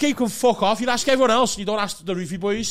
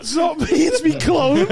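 theatrical repeat; 8 semitones higher, 6 dB louder than usual. Retold or imitated. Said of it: "sounds like a fucking play on the West End".

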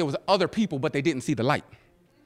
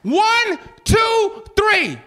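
counting loud; 11 semitones higher, much slower than usual. Real, not acted. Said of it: "That's all I need you to do".

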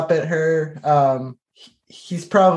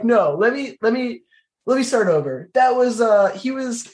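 flustered emotional stumbling; 8 semitones higher than usual. Acted. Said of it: "I'm just doing kind of, like, a terrible job".

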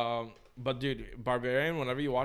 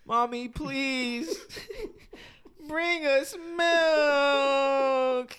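very slow, much higher, fake sobbing whine; mock crying plea; 10 semitones higher, 4 dB louder, much slower than usual. Voice message to a friend, hammed-up fake crying for milk. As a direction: drag it out like a wailing toddler.

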